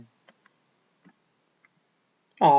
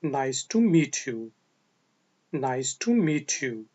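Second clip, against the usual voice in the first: slow model phrase; 3 semitones lower, much slower than usual. Shown as a demonstration. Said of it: "तो आप कहेंगे".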